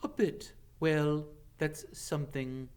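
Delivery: mild sigh. Acted. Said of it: "Ronan sighed".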